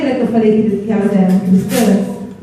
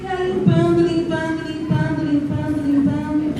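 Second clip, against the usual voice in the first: 1 fading soft repetition; 4 semitones higher, 6 dB quieter than usual. A narrator's reading. Repeated cleaning, fading.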